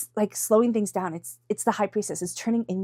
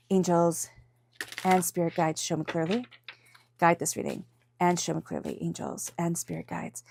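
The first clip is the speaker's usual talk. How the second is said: soft prayer; 3 semitones lower, 3 dB quieter than usual. Acted. Reader quietly invokes angels and spirit guides before the cards.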